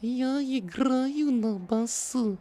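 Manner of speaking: sing-song parody of Я играю на басу; mocking translator. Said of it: "She changes intonation all the time".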